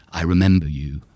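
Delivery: deadpan line reading; child actor line. Retold or imitated. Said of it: "I had the line".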